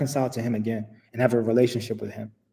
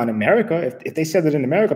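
normal talking voice

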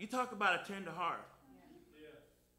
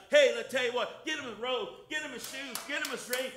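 louder, rising shout of a father welcoming his son; father's joyful shout; 3 semitones higher, 6 dB louder than usual. Retold or imitated. Father calling out to servants for his returned son, joyful shout. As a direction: call the servants, building to a joyful cry.

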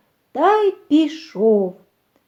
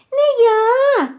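very high, loud mouse squeal; squeaking mouse; 12 semitones higher, 6 dB louder than usual. A narrator's reading. Mouse Vert refuses to knead dough, squeaking. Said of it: "пищить верть".

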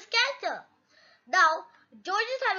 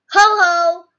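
loud, spoken ho-ho; acted hearty laugh; 15 dB louder than usual. Mister Bull amused at a shaky Daddy Pig, hearty acted laugh. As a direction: boom out a mock laugh. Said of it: "laughs Mister Bull".

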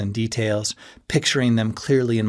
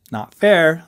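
louder protest, higher; objecting; 6 semitones higher, 6 dB louder than usual. A narrator's reading. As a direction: protest it playfully. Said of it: "I objected again".

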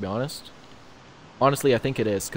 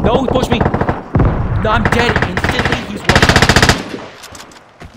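high loud frantic yell, drawn out; frantic shouting; 10 semitones higher, 10 dB louder, much slower than usual. Real, not acted. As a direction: scream it as you go down. Dying in firefight.

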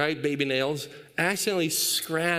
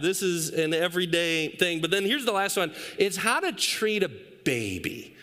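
ordinary delivery

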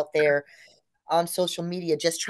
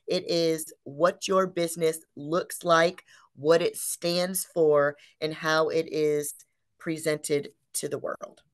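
usual speaking voice

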